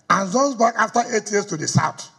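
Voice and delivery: ordinary delivery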